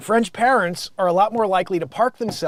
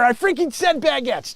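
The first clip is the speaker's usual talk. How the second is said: mock scolding; 7 semitones higher than usual. Mock rant at off-mic Joanne.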